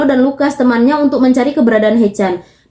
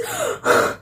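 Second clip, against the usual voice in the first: sharp gasp; 9 dB quieter than usual. Real, not acted. Emotional reaction to story.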